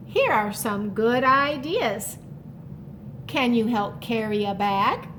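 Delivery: ordinary delivery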